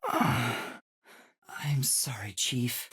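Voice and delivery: slow, whispered, heavy sigh into sorry; sighing apology. Acted.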